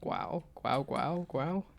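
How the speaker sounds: lightly voiced dog growl; dog noise demo. Shown as a demonstration. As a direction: say it like a dog's grr. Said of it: "do dog noises".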